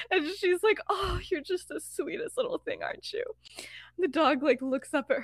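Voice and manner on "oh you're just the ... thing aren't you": soft, high cooing to a dog; acted baby talk to an animal; doting pet talk. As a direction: coo sweetly, higher and softer. Lily dotes on the dog.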